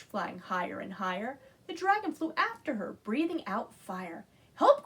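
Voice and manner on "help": very high, loud shout; cry for help. A narrator's reading. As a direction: scream for help. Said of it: "cried the witch".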